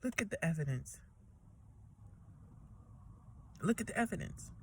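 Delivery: spent, barely pushing the words out; soft, exhausted murmur after the anger; quiet, drained. Real, not acted. Drained after rant, quiet recounting.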